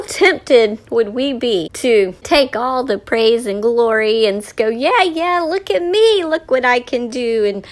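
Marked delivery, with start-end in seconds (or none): 4.7-7.4 high, hammed-up bragging; mock show-off, excited and high; boastful showing off. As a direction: crow for attention, pitched up. Acting out someone grabbing the glory.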